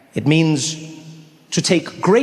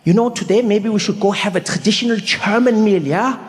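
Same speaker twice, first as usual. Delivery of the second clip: higher-pitched German friend voice; German accent; 5 semitones higher than usual. Acted. Friend suggesting a traditional German meal, German accent. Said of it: "Rolf turns to me and he's like".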